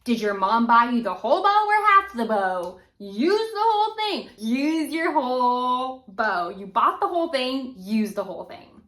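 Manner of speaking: mock scolding from a conductor; a hint of a stern conductor; quoting a conductor. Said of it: "I had a conductor tell me".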